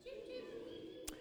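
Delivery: high, breathless little cheeps; sparrow cheep. Shown as a demonstration. Leader showing the sparrow group, tiny high cheeps. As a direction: peep softly like a small bird. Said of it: "can you go cheep".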